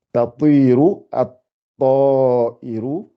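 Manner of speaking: slowly drawn out "tatiru at-ta'iru". Shown as a demonstration. Teacher reading the sentence to the class, very slow. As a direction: stretch every syllable of "tatiru at-ta'iru". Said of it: "faut bien, bien articuler".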